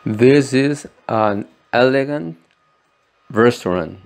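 This is an elegant restaurant, read slowly; slow model sentence. Shown as a demonstration. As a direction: read the English example slowly. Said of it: "example sentence read slowly". (frase modelo en inglés, lenta)

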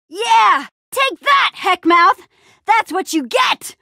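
high-pitched victory shouting; triumphant taunt. Acted. Taunting the Heckmouth after beating it, triumphant. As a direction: crow loudly over the beaten foe.

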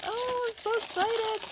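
high-pitched excited squeal. Real, not acted. Crafter spotting coffee cup shakers, excited high squeal. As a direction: let real excitement lift the voice.

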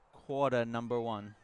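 lightly imitated announcer, dropped r in "quarter"; Alan Slaudazinski impression. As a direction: say "quarter" as "quah-tuh", a slight impression. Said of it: "my best impression of a Alan Slaudazinski".

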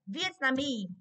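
"Vietnamese" stressed on MESE; stress on last syllable. Shown as a demonstration. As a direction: lean into the final syllable of "Vietnamese". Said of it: "trọng âm rơi vào mi".

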